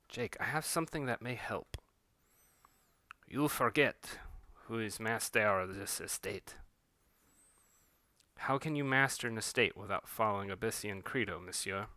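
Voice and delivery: put-on low, soft French-accented gentleman. The master of the estate addressing Jake, in a soft French accent. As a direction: speak it quietly with a French accent. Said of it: "I have attempted to practice some of my accents".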